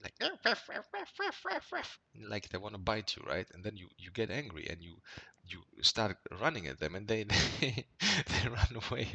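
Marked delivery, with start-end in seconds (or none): 0.2-2.0 high yapping ruff ruff; mock yap like a tiny angry dog; squeaky little dog barking; yappy small dog bark. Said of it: "the small dogs, when they bark". 5.5-9.1 laughing. Real, not acted. Laughing describing dogs fleeing.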